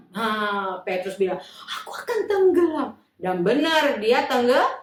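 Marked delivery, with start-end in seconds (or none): 1.8-2.9 frightened Peter. Acted. Peter says he will sink.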